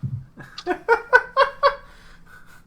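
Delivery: high, wheezy burst of real laughter; laughing. Real, not acted. Game master cracking up at the players, long high laugh. Amused by joke at table.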